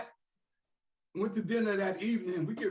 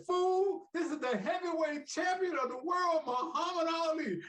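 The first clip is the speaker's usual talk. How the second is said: high, proud shouted announcement; imitating Ali's booming boast; Ali announcing; 9 semitones higher than usual. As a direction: proclaim yourself champ. Ali announcing himself on phone.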